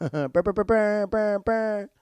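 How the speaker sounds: high, loud rolling brr bursts; goofy stuttering sound effect, high and loud; imitated rapid brr noise. Recapper mimicking Luke getting out of the car, goofy brr noise.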